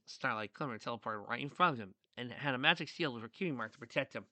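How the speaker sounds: usual speaking voice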